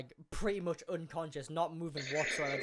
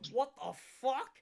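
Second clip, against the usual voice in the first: higher, put-on startled question; mock bewilderment; 7 semitones higher than usual. Kid just hit with a left hook, mock shock. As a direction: imitate a stunned kid, pitch lifted. Punched kid's reaction, acted out.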